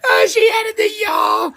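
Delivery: high, laughing shriek of delight; delighted outburst. Real, not acted. Host reacts to Pony's added y'all, delighted outburst. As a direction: cry out high, cracking up.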